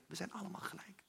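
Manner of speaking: whisper. Real, not acted. Derde herhaling gefluisterd.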